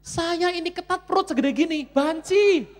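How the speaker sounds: very high, loud outburst; exasperated comic rant. Acted. Preacher protesting tight clothes on his belly, loud indignant shriek.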